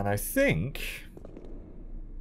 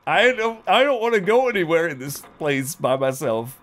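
clingy pleading; 8 semitones higher, 4 dB louder than usual. Retold or imitated. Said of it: "I'd be like".

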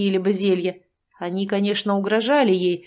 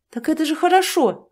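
high relieved exclamation; cheerful relief; 8 semitones higher, 3 dB louder than usual. Acted. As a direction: wave the worry away. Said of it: "облегчённо махнула рукой Дашка".

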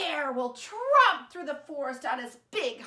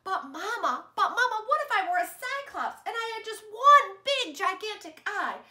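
put-on higher, louder eager little kid; eager child; 6 semitones higher, 5 dB louder than usual. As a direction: ask Mama like an excited child. Child pleading to Mama.

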